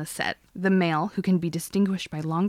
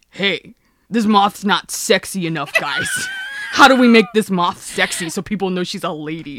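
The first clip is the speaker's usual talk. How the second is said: mocking producer voice; 7 dB louder than usual. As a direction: sneer as a clueless exec. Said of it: "what that means is".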